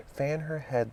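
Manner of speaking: a touch of alarm; Red Queen anxiously ordering the fanning. A narrator's reading. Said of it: "the Red Queen anxiously interrupted".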